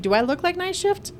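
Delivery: high incredulous retort; high-pitched sarcastic comeback. Retold or imitated. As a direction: fire it back, incredulous. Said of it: "I'm like".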